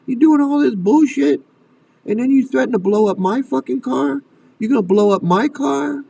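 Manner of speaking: high-pitched, indignant confrontation; outraged parent. Acted. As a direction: confront them like a furious parent, pitch climbing. Voices outraged parent confronting the kidnappers.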